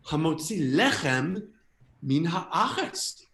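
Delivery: slow, wondering, each Hebrew word drawn out; sudden realization. Acted. Understanding the blessing for the first time, amazed. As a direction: stretch each word as its meaning dawns. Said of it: "suddenly I'm like, "Oh my God".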